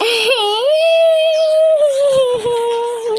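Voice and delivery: a high loud wailing sob. Acted. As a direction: wail out in regret. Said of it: "बहुत ज़्यादा रोती है". (ऊँची आवाज़ में रोना)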